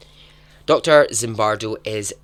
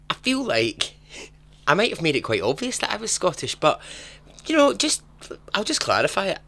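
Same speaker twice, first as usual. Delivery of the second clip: mock exasperation; 10 semitones higher, 3 dB louder than usual. Mock exasperated about being Scottish.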